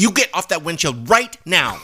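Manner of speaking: high, stern telling-off by a nun; hammed-up angry order, punching the last words; cross scolding. Joke nun acts cross at vampire.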